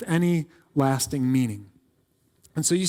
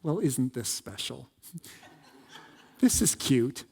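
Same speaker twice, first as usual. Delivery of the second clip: soft, lower, patronizing aside; condescending Solomon; 4 semitones lower, 6 dB quieter than usual. Acted. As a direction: play an unimpressed king damning with faint praise. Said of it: "he'd probably be like".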